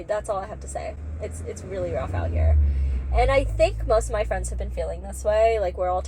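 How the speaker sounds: usual speaking voice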